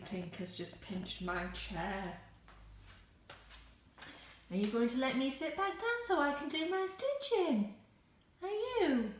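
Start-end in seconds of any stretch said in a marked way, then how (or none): 4.5-9.1 high teasing voice for the dog; talking to a dog. Real, not acted. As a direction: playfully scold the dog, pitched high. Dog took her chair.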